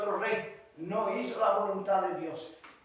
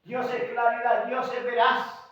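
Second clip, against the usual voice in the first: raised preacher's declaration, easing gradually; forceful preaching; 6 semitones higher, 10 dB louder than usual. Real, not acted. Preacher declaring that God keeps promises, forceful preaching. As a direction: proclaim each truth firmly.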